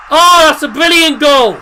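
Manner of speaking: high, loud delighted cry; excited shout. Real, not acted. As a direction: shout it out in disbelief. Goal celebration continues.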